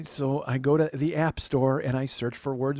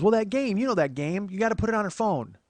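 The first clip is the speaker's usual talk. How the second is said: eager aunt; 6 semitones higher than usual. Retold or imitated. Aunt wanting a game with mom, eager aunt voice. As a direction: announce your plan as the aunt.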